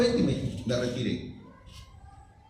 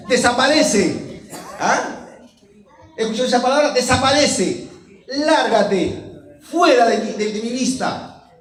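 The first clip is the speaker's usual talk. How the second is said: loud, higher-pitched commanding rebuke; forceful preaching; 9 semitones higher, 8 dB louder, slower than usual. Acted. Preacher quoting Jesus banishing Satan, commanding shout. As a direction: cast Satan out with each word.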